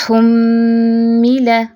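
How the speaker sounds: हुम्मिला with a long humming m; full ghunna. Shown as a demonstration. Teacher models full ghunna on meem, long nasal hum. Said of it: "भरपूर गुन्ना करना है".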